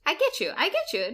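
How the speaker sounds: ordinary talk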